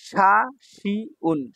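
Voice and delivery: শাসিউন very slow, final n sounded separately; syllable-by-syllable. Shown as a demonstration. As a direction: spell out শাসিউন in long separate beats. Teacher sounds out new word.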